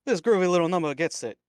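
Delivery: [name] lounge singer. Acted. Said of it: "smarmy lounge singer".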